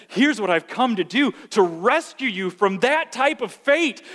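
loud, high, impassioned preaching; full-force preaching. Real, not acted. Preacher proclaiming rescue to congregation, impassioned shout. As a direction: build to a ringing, shouted proclamation.

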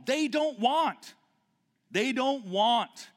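pulpit-pounding sermon peak; fiery preaching. Acted. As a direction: preach it hard, pressing each line.

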